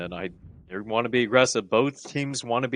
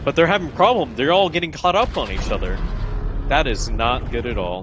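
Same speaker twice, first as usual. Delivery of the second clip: raised, urgent play-by-play call; esports caster; 2 semitones higher, 3 dB louder than usual. Real, not acted. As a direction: call the fight live, pushing up. Live match play-by-play.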